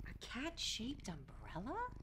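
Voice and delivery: slow, soft puzzled question; a hint of disbelief. Real, not acted. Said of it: "surprise at umbrella".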